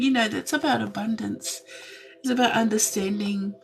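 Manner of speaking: usual speaking voice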